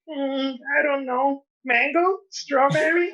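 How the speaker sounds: high, slow and whiny with unsure rising questions; clueless moron. Retold or imitated. A comic mocking himself ordering flavors at a bar, high whiny and unsure. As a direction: play the helpless customer, a whimper in each flavor. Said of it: "I feel like a moron because I'm like".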